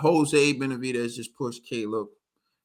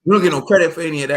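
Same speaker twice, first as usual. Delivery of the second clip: fast, louder mock protest about getting no credit; loud complaint; 4 semitones higher, 9 dB louder, much faster than usual. Real, not acted. Host fast and loud to his cohost, complaining about no credit. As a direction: rattle it off fast and loud, half joking.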